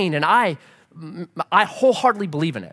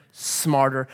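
usual speaking voice

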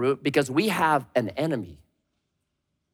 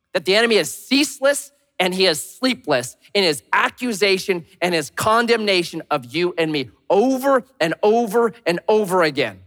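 ordinary talk, nothing marked

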